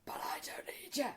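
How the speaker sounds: unvoiced whispered brush-off. Whispering to the salesman, I don't need you. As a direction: breathe it out, cold and secret.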